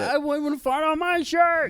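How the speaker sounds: put-on high, slow whiny child; mock a snobby little kid; squeaky kid voice. Mocking a child fan.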